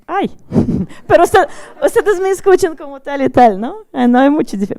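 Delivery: amused surprise, loud and high; surprised. Real, not acted. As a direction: caught off guard, pitch and volume jump up. Reacts to sudden sound change.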